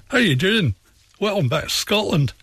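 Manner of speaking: mock a Scottish accent as a cheery welcome. Guest imitating the Scots across the river, light Scottish accent. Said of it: "talk with a very different accent".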